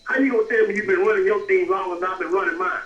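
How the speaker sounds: ordinary talk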